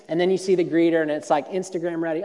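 ordinary delivery

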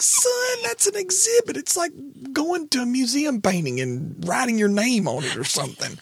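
high-pitched exasperated scolding of a son; exasperated dad. Retold or imitated. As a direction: retell it as a dismayed, squeaky-high dad. Dad retells scolding his son.

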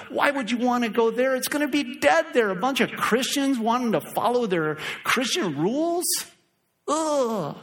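high, sneering mock Satan voice, ending in a scornful ugh. Pastor mimicking Satan to his congregation, high sneering voice. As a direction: lift the voice high and sneer like the devil. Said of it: "Don't let Satan go".

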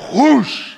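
loud, high whoosh of a hurled storm; a big whooshing sound effect; imitated whoosh. Preacher acting out God hurling a storm, loud whoosh.

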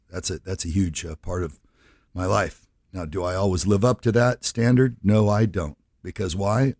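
normal talking voice

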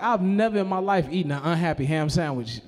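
ordinary delivery

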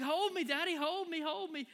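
put-on high, loud begging child voice; cling and plead like a cold little girl; pleading toddler. Daughter begging dad to hold her, high and loud. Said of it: "she's like".

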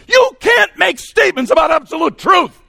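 high, loud, furious mock shout; ticked-off student. Angry student challenging the speaker at Q and A, ticked-off yelling. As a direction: yell the objection, amped like crazy. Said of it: "he was ticked".

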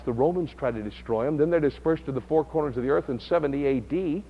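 ordinary delivery

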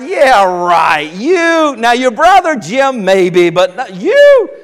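amused scoffing granddad, slow at the end; laughing disbelief. Retold or imitated. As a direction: chuckle it off in disbelief. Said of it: "And he laughed".

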